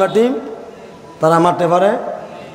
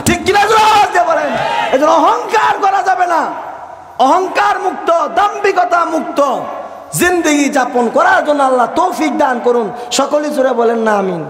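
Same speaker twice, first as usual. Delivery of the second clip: fiery preaching; 10 semitones higher than usual. Acted. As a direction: rouse the crowd and demand they answer back. Preaching at full force.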